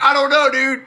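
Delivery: high-pitched, baffled denial; bewildered protest. Retold or imitated. Bar-goer answering a stranger picking a fight, bewildered protest.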